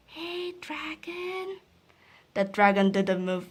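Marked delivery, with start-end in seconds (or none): 0.1-1.6 soft, slow, high whisper; whispered very softly. Acted. Princess checking the sleeping dragon, soft whisper. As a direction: test if he's asleep, barely a breath. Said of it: "whispered very softly".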